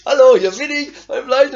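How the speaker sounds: loud, brash voice of the flesh; flesh announcing itself. Acted. Preacher plays his flesh barging in, loud and brash. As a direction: burst in like a pushy intruder. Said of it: "meldet sich ... mein Fleisch".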